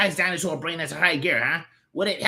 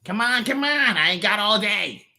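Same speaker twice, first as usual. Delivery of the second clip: impatient, raised character voice; 12 semitones higher, 4 dB louder than usual. Acted. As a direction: hurry the bug along. Impatient demand to the bug.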